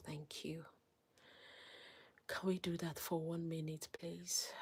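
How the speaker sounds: hushed whispered plea to viewers; soft begging whisper. Real, not acted. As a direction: whisper it, coaxing them to subscribe. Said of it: "whispering plea to viewers".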